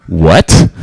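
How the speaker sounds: low, loud mock disbelief; loud, deep-pitched startled challenge; incredulous cop. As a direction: imitate an officer balking at the idea. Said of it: "Can you imagine a police officer".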